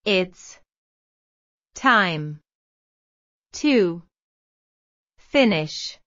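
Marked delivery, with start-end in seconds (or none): none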